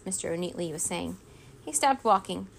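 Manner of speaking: ordinary delivery, nothing marked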